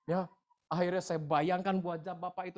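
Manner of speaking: usual speaking voice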